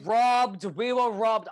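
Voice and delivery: loud, high cry of outrage; indignant outburst. Real, not acted. Co-host laments a favorite show's cancellation, loudly indignant. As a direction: protest it loudly, pitched well up.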